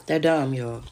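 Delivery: ordinary delivery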